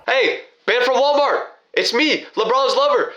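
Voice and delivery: high, carrying call across the dark. Retold or imitated. Jogging toward a light, calling to his friend. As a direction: hail someone far off, cheerful.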